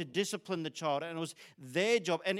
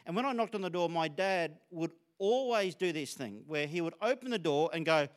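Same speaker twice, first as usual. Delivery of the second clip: ordinary delivery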